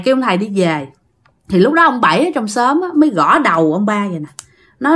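plain reading voice

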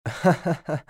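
high, acted scornful chuckle; short sarcastic ha-ha; mocking laugh. A narrator's reading. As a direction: laugh in mockery at a friend. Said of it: "ضاحكا بسخرية قائلا".

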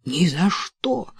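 mild protest, slowing down on the end; mother objecting in a mild exclamation; exclaiming. A narrator's reading. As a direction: plead against it, drawn out. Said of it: "воскликнула мать".

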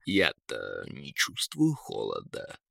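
low, slow, soft eagle; eagle's voice. A narrator's reading. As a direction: muse on it deep and unhurried. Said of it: "спохватился Эрвин". (низкий задумчивый голос орла)

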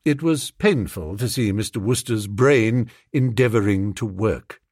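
ordinary delivery, nothing marked

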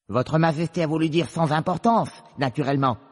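high, loud rabbit interjection. Acted. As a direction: cut in with a high, loud put-on voice. Le lapin blanc intervient auprès du roi. (voix de lapin, aiguë et forte)

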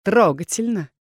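villain's put-on pity; feigned sympathy. A narrator's reading. Rebel leader at the sobbing heroine, mock-sympathetic. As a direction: sympathise with obvious insincerity. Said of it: "наигранно сочувствующие нотки".

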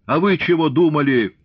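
high, loud accusing question; enraged outburst, acted. A narrator's reading. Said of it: "взбесился, заорал".

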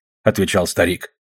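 ordinary delivery

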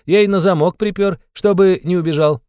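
merry high-voiced taunt; playful, gleeful interrogator. A narrator's reading. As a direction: confide it with mischievous relish. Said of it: "становился всё веселее и игривее".

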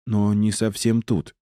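slow, hesitant, mildly mumbled admission; mumbling. A narrator's reading. Tracker admits the owner is not quite here, mumbling slowly. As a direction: drag it out uncertainly. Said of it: "промямлила ищейка".

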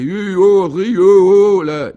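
high, very loud braying cries; howling horse. Acted. A horse sets to howling at the travellers, loud braying. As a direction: holler out like a cartoon horse. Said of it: "le cheval se mit à hurler".

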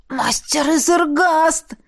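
slow, high rasped cry; hoarse croak. A narrator's reading. Revived man hailing his master from the coffin, hoarse. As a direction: croak it out, just back to life. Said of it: "прохрипел Эрт".

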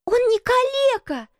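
put-on very high, indignant girl's shout; high girlish indignation; indignant cry. A narrator's reading. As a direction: cry out in outrage at top pitch. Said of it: "с негодованием громко крикнула Мэри".

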